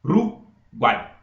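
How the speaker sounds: "Uruguai" slowly in three bursts: U, RU, GUAI; separated syllables. Shown as a demonstration. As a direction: break "Uruguai" apart with clear pauses. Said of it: "porque eu falo separado".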